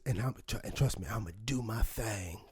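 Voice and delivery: low, breathless whisper of the line; softly husked tough-guy promise; hushed mock movie line. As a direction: whisper it like a brooding action hero. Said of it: "he goes".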